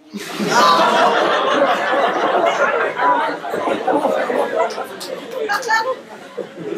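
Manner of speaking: long high laughing fit; long laughter. Real, not acted. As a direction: crack up laughing for a while. Laughs at bathroom story.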